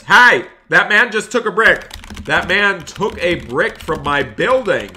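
loud, high shout about the thief; outraged shout. Acted. Banker shouting that a man stole a brick, outraged. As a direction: yell it out to everyone.

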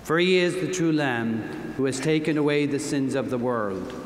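ordinary delivery

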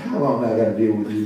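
low, quick grumble of annoyance; mock weary irritation; fed up. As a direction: grumble it like someone is on your nerves. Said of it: "get on your nerve".

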